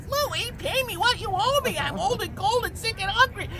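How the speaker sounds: put-on high, frail old widow voice; old widow begging for her rent, high voice; little old lady voice. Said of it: "hear Missus Fontenot's voice".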